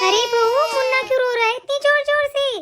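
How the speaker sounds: put-on aunt calling loudly as the baby cries. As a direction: call out worriedly toward the bahu. Aunt calls out entering house.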